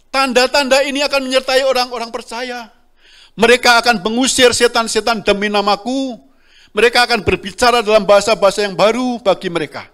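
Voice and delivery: loud, high preaching read of the verses; scripture proclaimed. Acted. Preacher reading Mark to the congregation, loud proclaiming. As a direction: proclaim the verses at full force to the church.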